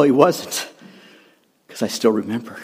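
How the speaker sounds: ordinary talk, nothing marked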